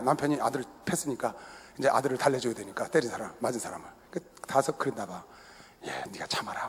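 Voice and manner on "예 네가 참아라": hushed, much softer and lower; a mother's hushed comfort; whisper. Retold or imitated. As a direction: soothe him in a whisper. Wife quietly soothing the son.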